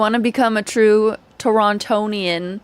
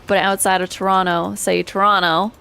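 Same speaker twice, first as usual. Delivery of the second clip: ordinary talk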